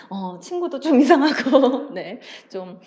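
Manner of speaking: laughing through the words; real laughter. Real, not acted. Teacher laughing while summing up Hyori's bad day. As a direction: giggle while wrapping up.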